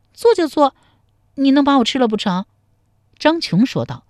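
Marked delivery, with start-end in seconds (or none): 0.1-2.4 blushing bravado. A narrator's reading. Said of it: "红着脸说".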